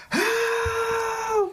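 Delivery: high, drawn-out mock scream; screaming for help. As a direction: call out as a fleeing victim. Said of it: "they're running and screaming".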